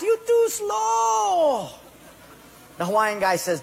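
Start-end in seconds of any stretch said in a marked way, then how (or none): 0.0-1.7 high, very slow jeering shout; mocking Portuguese guy. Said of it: "that Portuguese guy laughed".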